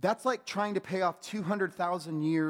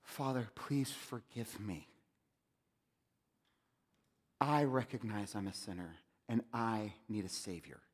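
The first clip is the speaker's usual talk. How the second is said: hushed prayer; 5 semitones lower, 6 dB quieter than usual. Retold or imitated. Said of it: "when I cried out to God and I said".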